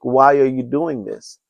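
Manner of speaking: ordinary talk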